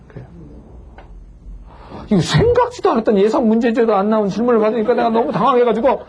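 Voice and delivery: ordinary talk, nothing marked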